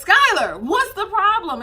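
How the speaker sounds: high, loud mock alarm; retold frantic questioning; exasperated calling out. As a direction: call the baby by name, pitched right up. Retells calling to the screaming baby.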